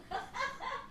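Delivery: short high laugh. Real, not acted. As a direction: let a giggle out. Laughs at friend.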